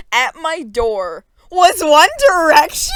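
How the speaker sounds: loud, slow disbelieving shout; shocked reveal. A narrator's reading. Girl opening door to boy band, loud shocked shout. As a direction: gasp it out in shock.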